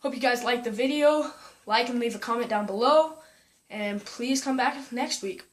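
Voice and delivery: cheerful YouTube goodbye; upbeat vlog outro. Shown as a demonstration. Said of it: "That was horrible".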